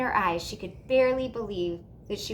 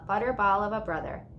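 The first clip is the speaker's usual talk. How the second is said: ordinary delivery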